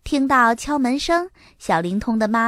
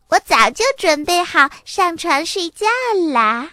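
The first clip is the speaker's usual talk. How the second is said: put-on high, sweetly obedient child voice; well-behaved little girl; 7 semitones higher, 3 dB louder than usual. A narrator's reading. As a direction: pipe up like a good little sister. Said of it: "多拉乖巧地说". (乖巧的小女孩声)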